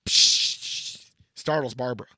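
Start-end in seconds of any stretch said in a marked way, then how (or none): 0.1-1.0 glass shatter. Acted. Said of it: "sound of shattering glass".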